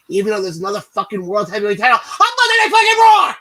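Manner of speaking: loud frustrated yell; exasperated rant. Real, not acted. Co-host ranting to listeners about two titles, loud exasperated yell. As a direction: vent it loud like a fed-up fan.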